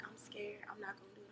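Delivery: imitating nervous whisperers; very soft, nearly voiceless, backing out; scared whisper. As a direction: whisper it like a reluctant contestant. Nervous contestants waiting, acted out.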